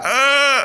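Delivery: high strangled "argh"; mock agonised scream. Imitating a man with his head in a vice, mock scream. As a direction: imitate a squeezed cry of pain.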